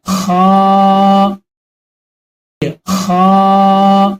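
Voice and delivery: "خا" stretched very slowly with a long rasp; slow teacher's "خا" demo; drawn-out kha. Shown as a demonstration. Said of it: "بولیے".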